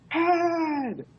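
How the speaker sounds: high, drawn-out mock crowd shout. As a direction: shout it back like an arena crowd. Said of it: "the crowd would shout".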